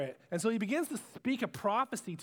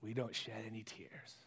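muttered dismissal; 10 semitones lower, 12 dB quieter than usual. Acted. Crowd dismissing Tyre.

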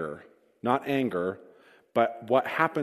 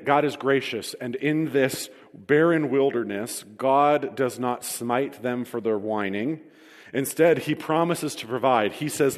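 usual speaking voice

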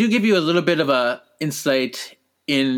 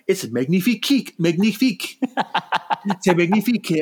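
put-on French accent, higher voice; French chef; 5 semitones higher than usual. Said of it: "he goes".

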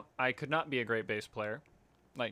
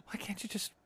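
pleading; 8 dB quieter, slower than usual. Real, not acted. Begging friend to read the line.

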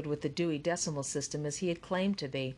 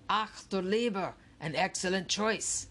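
lightly voiced old German man, pleased; a German-accented ghost welcoming the visitors; thick German accent. A narrator's reading. A ghostly printer greets the teammates in a German accent. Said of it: "said a man with a thick German accent".

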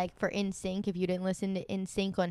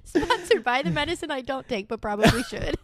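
laughing; 5 semitones higher, 5 dB louder than usual. Pretend. Joking ad read while laughing.

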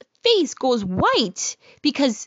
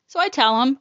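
usual speaking voice

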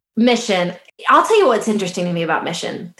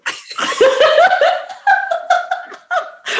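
high burst of laughter. Real, not acted. Cracking up at own joke.

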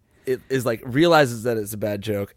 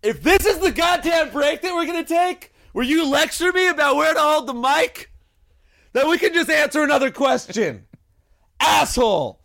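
mock angry shout. Cohost lectures him about the mic, he yells back mock furious. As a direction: blow up at him in a joking shout. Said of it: "that was probably a little better".

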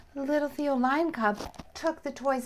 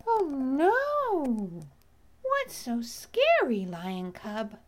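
bee character voice; slower than usual. Acted. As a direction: play a startled bee, pitched up at first. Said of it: "said the voice of the bee".

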